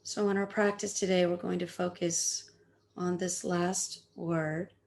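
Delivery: ordinary talk, nothing marked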